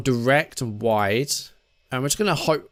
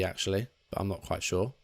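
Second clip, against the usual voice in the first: usual speaking voice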